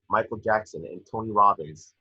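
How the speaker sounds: normal talking voice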